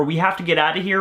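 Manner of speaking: ordinary talk, nothing marked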